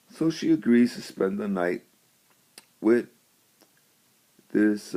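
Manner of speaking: ordinary delivery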